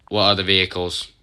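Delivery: ordinary talk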